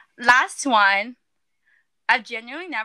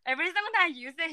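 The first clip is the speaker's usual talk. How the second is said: usual speaking voice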